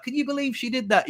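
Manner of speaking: high, incredulous venting; mock-outraged whine; indignant disbelief. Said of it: "I was like".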